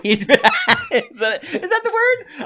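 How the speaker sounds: laughing. Real, not acted. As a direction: laugh through the question. Laughing at own word.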